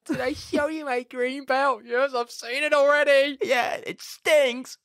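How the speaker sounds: hammed-up family banter; falsetto kid voices. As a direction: squeak it out mockingly.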